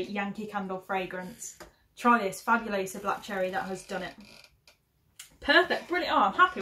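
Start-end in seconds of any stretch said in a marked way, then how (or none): 5.4-6.6 excited. Real, not acted. Delighted at scent.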